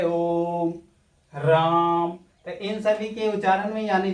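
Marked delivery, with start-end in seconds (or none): 0.0-2.1 ओ and राम held for three beats; drawn-out vowels. Shown as a demonstration. Teacher demonstrating pluta vowels to students, long held vowels. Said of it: "उच्चारण सही से करें".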